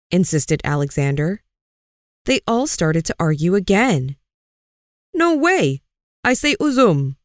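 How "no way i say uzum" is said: Aziz shouting his choice over the others, pitched high. A narrator's reading. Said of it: "shouted Aziz".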